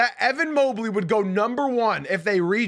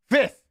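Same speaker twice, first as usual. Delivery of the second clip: Fifth punched out louder and higher, alone; emphatic single word; 5 semitones higher, 5 dB louder than usual. Real, not acted. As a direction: slam down the word Fifth by itself. Hammering home the player's rank.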